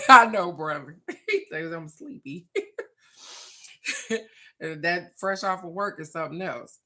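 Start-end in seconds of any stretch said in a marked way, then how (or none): 0.1-2.6 loud laugh through the words. Real, not acted. Laughing back at a viewer's teasing comment. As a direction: burst out laughing on I know.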